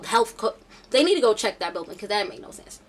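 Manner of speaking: ordinary talk, nothing marked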